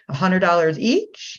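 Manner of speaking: slow, with "each" lifted into a question; unconfident uptick. Retold or imitated. Host imitating the coach's wrong price delivery, unconfident uptick. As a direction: tip "each" upward as if unsure. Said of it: "like what you just did".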